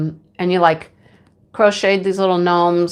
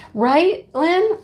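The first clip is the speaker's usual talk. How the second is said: ordinary talk, nothing marked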